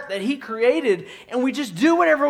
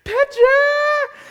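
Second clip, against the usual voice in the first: high, loud wail of Petra; a toddler wailing the word for picture; crying toddler; 7 dB louder than usual. Retold or imitated. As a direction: cry Petra like a sobbing child. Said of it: "She says picture".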